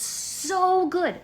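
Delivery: high, slow, drawn-out gush of delight. Real, not acted. Gushing to viewers about home Mexican food, high and slow. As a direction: savour every word like the taste.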